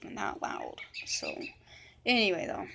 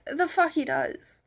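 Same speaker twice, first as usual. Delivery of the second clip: louder, higher and drawn out; indignant retort; 6 semitones higher, 6 dB louder, slower than usual. Retold or imitated. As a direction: imitate an incredulous comeback. Retold angry retort to Lisa's claim.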